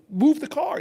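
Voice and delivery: high, insistent mock plea; urgent mental command. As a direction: mock-desperate order. Acting out urgent telepathic command.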